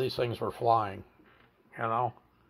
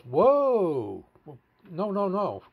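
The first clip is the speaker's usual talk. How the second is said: long, high, louder "whoa", then high no's; retold halting call; 6 semitones higher, 4 dB louder, much slower than usual. Camper stopping a man bolting for the beach, retold halting call. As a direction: imitate someone calling a runner to a stop.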